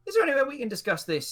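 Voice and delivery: high, polite ask; hesitant high voice. Acted. Cobb to the innkeeper, asking for privacy in a high voice. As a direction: pitch it up, a cautious request. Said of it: "sort of go".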